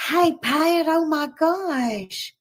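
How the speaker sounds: high, delighted hello; light up as a friend walks in; excited greeting. Real, not acted.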